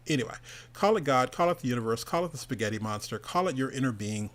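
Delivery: normal talking voice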